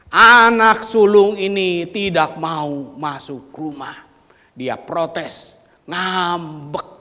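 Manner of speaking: loud, slow preaching; forceful preaching. Acted. Preacher loudly describing the elder son's sulking. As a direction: drive home the sulking son.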